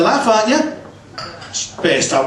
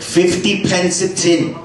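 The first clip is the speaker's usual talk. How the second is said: mock Pakistani accent; 3 semitones higher, slower than usual. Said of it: "Pakistani specialists".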